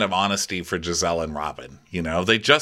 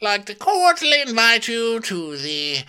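high, loud, very slow put-on voice; hammed-up high proclamation; mock Karen invitation; 5 dB louder, much slower than usual. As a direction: drag it out like a pageant host. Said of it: "mocking Karen's invitation".

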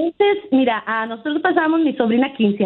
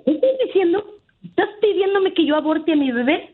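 higher, shocked outrage, imitated; indignant niece; 6 semitones higher than usual. Caller imitates niece confronting her father, higher and indignant. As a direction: voice the teen's indignant disbelief.

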